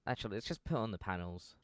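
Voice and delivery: ordinary talk, nothing marked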